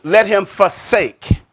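preacher hammering home "forsake", slowly; stress on forsake. Shown as a demonstration. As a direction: draw out "forsake" with weight. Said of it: "So now the emphasis is".

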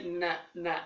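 "ن" with no vowel before it; bare letter sound. Shown as a demonstration. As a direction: softly sound out "ن", not "en". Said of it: "نون اللي بنطقها إزاي؟".

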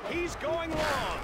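slow, high drawn-out call; rising call. Acted. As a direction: stretch it out as the ball flies. Commentator calls a long pass.

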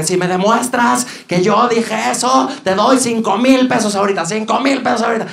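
high-voiced imitation of a challenge; challenging celebrity. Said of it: "le dice así de que".

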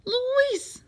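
very high exclamation; shocked cry. A narrator's reading. Parents shocked at the construction crew, crying out. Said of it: "they cried".

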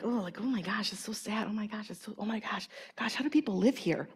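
hushed, breathy mock gasp of dismay. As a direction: murmur it as if shocked and saddened. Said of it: "I'm like, and I get like".

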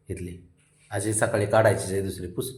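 ordinary talk

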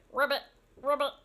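quick frog croaks; two brisk, playful ribbits. Shown as a demonstration. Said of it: "What sound does the frog make?".

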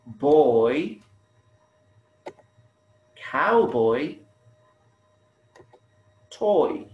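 put-on boy, cowboy, toy, isolated with a drawn-out "oy"; highlighted rhyme. Shown as a demonstration. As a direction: stretch the shared ending of "boy", "cowboy", "toy". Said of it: "notice the similar sound".

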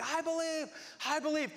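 high-pitched cry of an eager believer; mock screaming. Man in the front of the crowd, mock screaming. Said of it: "like, screaming".

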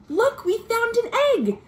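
gasp, then high and quick; thrilled discovery for the kids; high excited find. Acted. Finding egg under flap.

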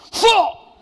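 sudden high yelled smack noise; loud slap shout. Retold or imitated. Father smacking his son, a loud yelled slap. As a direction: yell the slap sound.

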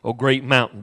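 scripture reading. Acted. Preacher reading scripture softly to the congregation. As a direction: read the verse low and hushed.

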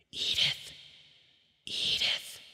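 soft voiceless whisper of a fairy. A narrator's reading. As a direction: breathe the name in her ear. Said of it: "a little voice whispered in her ear".

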